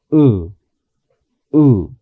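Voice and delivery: "U" with lips barely parted, short; short English U. Shown as a demonstration. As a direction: say "U" relaxed, lips apart. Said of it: "De esa forma".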